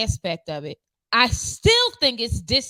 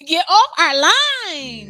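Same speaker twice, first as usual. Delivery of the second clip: loud, drawn-out mock holler; mock yell; 3 semitones higher, 8 dB louder, much slower than usual. Telling the eavesdropping FBI off, in a playful yell. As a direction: holler it slowly at imaginary eavesdroppers.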